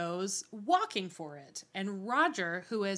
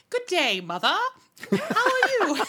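high, loud mock-posh little boy; posh toddler; 11 semitones higher, 9 dB louder than usual. Podcaster mocking oversized Jemmy's manners. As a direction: ham up a prim toddler greeting his mother.

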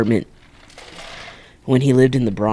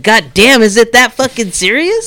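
loud, much higher, fed-up yell; exasperated outburst; 11 semitones higher, 7 dB louder than usual. Retold or imitated. Retelling how he snapped at the warning, loud and exasperated. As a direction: blurt it out loud like you can't believe it.

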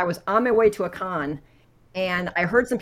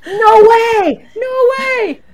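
high, loud squeal of disbelief; amazed disbelief; 13 dB louder, much slower than usual. Retold or imitated. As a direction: gasp it out, thrilled and stunned. Friend turns around amazed.

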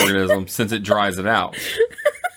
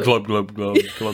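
fish glubbing sounds; gurgling fish man croak; 2 semitones lower than usual. Retold or imitated. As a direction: gurgle it like a goldfish. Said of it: "it's on the".

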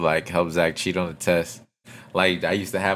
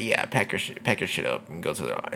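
retold quiet order; 3 semitones lower, 10 dB quieter than usual. Retold teacher sending student out.

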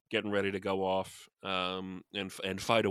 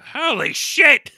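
a huge, high, drawn-out yell; startled shout; 16 dB louder, much slower than usual. Pretend. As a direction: emus shocked by gunfire, scream it. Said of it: "and it's like".

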